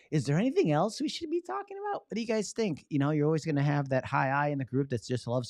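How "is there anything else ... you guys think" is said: mock the chatty host, voice pitched way up; dragging meeting host. Shown as a demonstration. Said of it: "Don't be like".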